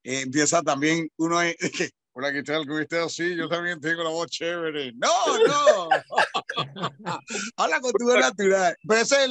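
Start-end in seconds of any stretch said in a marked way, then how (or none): 2.1-4.9 put-on announcer voice. Host mocking how interviewers copy announcers, put-on voice. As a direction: slip into a lowered, affected radio voice. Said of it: "esa es la voz impostada de Luchín".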